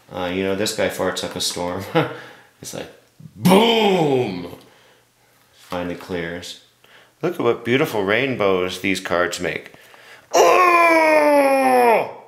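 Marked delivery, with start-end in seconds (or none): 3.3-4.5 loud mock explosion; fart sound effect. Mimicking the unicorn's fart. 10.3-12.2 high, loud hammed-up wonder; mock awe at the rainbow; amazed gasp. Marvelling at the rainbow.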